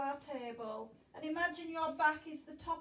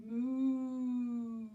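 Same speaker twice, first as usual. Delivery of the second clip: a louder, hearty cow moo; 5 dB louder than usual. Pretend. Teacher moving the kids into cow pose, hearty moo. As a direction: give a big cow moo. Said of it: "Into our cow".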